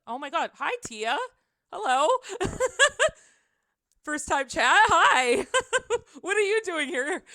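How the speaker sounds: high, loud, giggly surprise; real fangirl fluster, laughing between phrases; starstruck greeting. Real, not acted. Streamer greeting a surprise first-time chatter, starstruck and giggly. Streamer spots admired visitor in chat.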